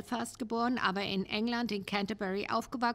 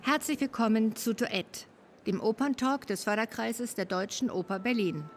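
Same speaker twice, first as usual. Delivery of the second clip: measured, polished presenter read over music, a little louder; formal podcast intro read; 4 dB louder than usual. Acted. Host welcoming listeners to the opera podcast, polished presenter read. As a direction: announce the show like a radio host.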